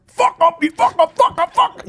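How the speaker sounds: high, loud repeated yell; Belfast bouncer. Acted. Bouncer as game character on repeat, high shouted abuse. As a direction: bark it over and over, very high. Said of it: "you'd have the Belfast bouncer".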